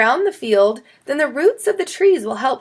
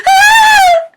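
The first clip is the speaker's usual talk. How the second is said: sudden shrill high scream, much louder; 12 dB louder than usual. A narrator's reading. Chloe screaming at the worm in her face, loud high scream. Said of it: "Chloe screamed".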